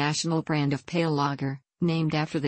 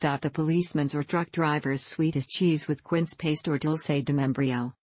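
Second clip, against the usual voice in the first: ordinary talk, nothing marked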